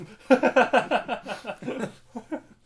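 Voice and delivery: hearty laugh, high and loud; loud burst of laughter. Real, not acted. Laughs at own sad joke.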